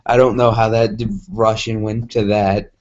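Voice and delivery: plain reading voice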